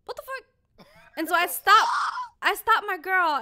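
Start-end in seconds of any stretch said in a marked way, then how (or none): 0.1-0.9 high, shocked what the fuck; retold shock. Friend retelling a shock, high disbelieving. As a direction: squeak it out in disbelief. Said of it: "And I'm like".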